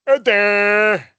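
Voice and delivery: loud, dopey drawn-out mock voice; drooling dimwit. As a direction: mimic clueless bosses, dumb and droning. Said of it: "Somebody's drooling, maybe somebody's asleep".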